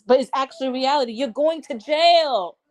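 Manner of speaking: angry plea to wake up; heated scolding. Real, not acted. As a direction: tell him off in real frustration. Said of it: "I didn't mean to curse".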